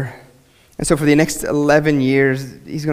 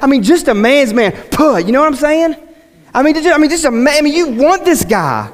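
higher, impassioned preacher voice; fervent preaching; 10 semitones higher, 4 dB louder than usual. Acted. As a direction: preach it with rising fervour. Preacher at full force.

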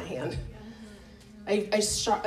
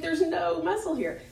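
exasperated protest; 7 semitones higher than usual. Retold or imitated.

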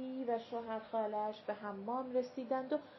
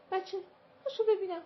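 sharp scolding; 9 semitones higher, 6 dB louder, much faster than usual. A narrator's reading. Mother scolding the boy.

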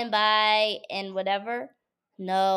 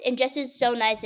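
ordinary talk

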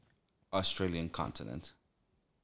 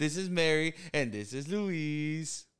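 put-on slow, higher, louder Spanish-accent intro; Spanish-accented introduction; 7 semitones higher, 5 dB louder, slower than usual. Showing how Peruvians introduce themselves, slow Spanish accent. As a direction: savor each name like a host. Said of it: "that's how we introduce ourselves".

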